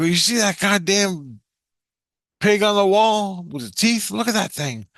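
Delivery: high, stretched-out cry of wonder; amazed exclamation. Retold or imitated. Dreamer pointing out the boar to visitors, amazed. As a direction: marvel at it, pitched well up.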